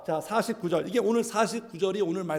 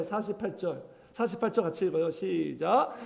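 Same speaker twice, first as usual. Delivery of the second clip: normal talking voice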